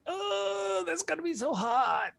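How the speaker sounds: high, soft mock moan of dread; mock whining. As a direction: whine about the heat in a tiny high voice. Acts out his dread of heat.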